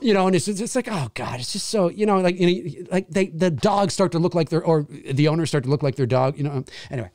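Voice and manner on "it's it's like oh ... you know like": ordinary talk, nothing marked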